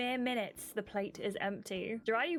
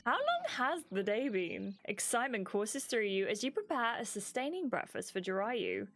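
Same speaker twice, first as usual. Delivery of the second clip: usual speaking voice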